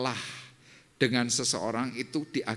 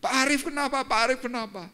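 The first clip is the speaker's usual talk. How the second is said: worried friend; 3 dB louder than usual. Retold or imitated. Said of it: "dia nanya".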